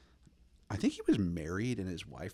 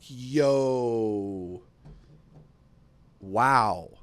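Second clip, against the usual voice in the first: stunned wow; 7 dB louder than usual. Retold or imitated. Reacting to her video.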